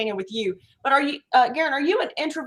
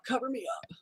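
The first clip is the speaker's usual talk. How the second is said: much softer, drowsy repeat; sleepy murmur; 12 dB quieter than usual. Retold or imitated. Joking that Juno wants to be tucked in, soft sleepy murmur. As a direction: mumble it like a dozing dog. Voicing the dog's lazy wish.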